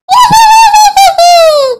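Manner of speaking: high shrill-free evil cackle; witch cackle. Acted. Witch laughing after threatening the family, evil cackle.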